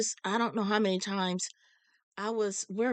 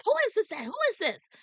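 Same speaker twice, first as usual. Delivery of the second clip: excited question; 13 semitones higher, much faster than usual. Retold or imitated. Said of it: "She's like".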